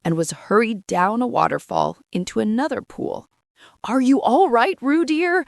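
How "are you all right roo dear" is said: higher, louder worried mother calling; anxious call. A narrator's reading. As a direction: call out anxiously to her child. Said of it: "called Kanga anxiously".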